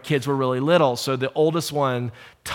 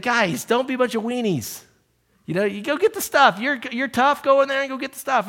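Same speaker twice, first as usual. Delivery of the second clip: teasing dad; 11 semitones higher than usual. Retold or imitated. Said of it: "And I said".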